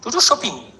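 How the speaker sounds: ordinary talk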